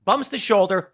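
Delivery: normal talking voice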